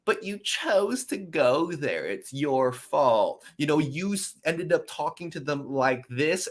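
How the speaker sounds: mocking commenters. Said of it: "I see the comments like".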